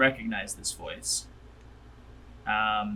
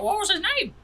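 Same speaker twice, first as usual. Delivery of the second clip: high character voice; 3 dB louder than usual. Acted. GM voices an NPC.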